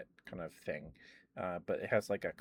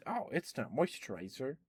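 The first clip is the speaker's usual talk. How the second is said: mock surprise; 6 semitones higher than usual. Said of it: "that killed me".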